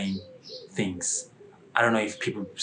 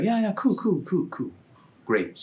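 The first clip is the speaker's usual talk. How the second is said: hasty agreement; 9 semitones higher than usual. Retold or imitated.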